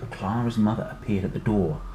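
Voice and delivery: ordinary delivery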